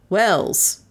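plain reading voice

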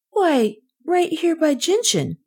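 high-pitched eager account; earnest child. A narrator's reading. Kay tells the policeman about the chase, earnest.

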